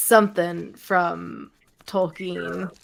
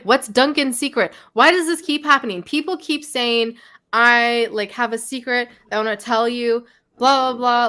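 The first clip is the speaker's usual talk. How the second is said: exasperated rant; 3 semitones higher, 4 dB louder than usual. Retold or imitated. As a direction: throw up your hands in disbelief. Retelling own exasperated reaction.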